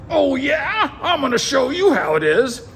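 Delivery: high, hammed-up royal rage; mock furious shout. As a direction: yell it way up high like a cartoonishly mad king. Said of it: "he gets really mad".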